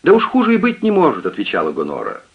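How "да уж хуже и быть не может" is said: higher, fussy lament of a servant woman; distressed maid. A narrator's reading. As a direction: wail it like a flustered maid. Maid answers Jones.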